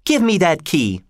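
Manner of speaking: put-on high-pitched insistent girl; exclaimed demand. A narrator's reading. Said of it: "exclaimed Kathy".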